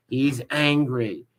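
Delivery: "He's angry." drawn out slowly, each word weighted; slow mocking repeat. Shown as a demonstration. Streamer mocking a rival for viewers, "He's angry." said slowly. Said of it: "So he's angry".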